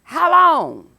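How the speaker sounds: loud, high, anguished how long; prophet's pleading cry. Acted. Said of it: "Habakkuk opens with the words".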